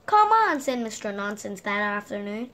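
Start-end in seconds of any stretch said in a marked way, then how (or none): 0.1-0.6 higher and louder; eager call. Acted. Mister Nonsense inviting his friend to go tobogganing, eager call. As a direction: call him along eagerly.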